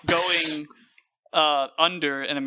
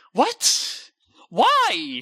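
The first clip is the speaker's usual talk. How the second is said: high-pitched, incredulous outburst; baffled disbelief; 11 semitones higher than usual. Real, not acted. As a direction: cry out as if it makes no sense. Baffled by VR roller coaster idea.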